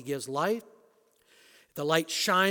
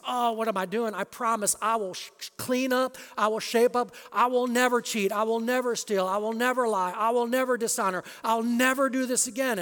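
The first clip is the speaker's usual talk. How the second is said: higher and louder, never hit each time; desperate vow; 6 semitones higher, 5 dB louder than usual. Retold or imitated. Churchgoer vows to God he'll never sin again, desperate. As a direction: swear off every sin, rising anguish. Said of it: "And then you say".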